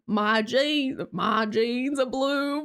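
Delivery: slow hillbilly drawl on "jeans are blue"; mock hillbilly accent. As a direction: stretch it in a put-on hillbilly accent. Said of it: "a hillbilly accent".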